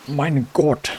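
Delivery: high-pitched mock German exclamation; German accent. Player warming up for a character, exaggerated German accent. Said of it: "do a German accent".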